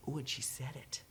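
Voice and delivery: quiet, slow, impressed murmur; hushed awe. Retold or imitated. Educators reacting to the video, quietly awed. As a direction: let it sink in softly. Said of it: "What they're gonna do is go".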